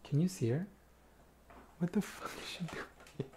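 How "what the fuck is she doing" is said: hushed whisper. Real, not acted. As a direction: whisper so the cat won't notice. Whispering watching cat.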